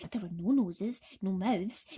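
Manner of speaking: plain reading voice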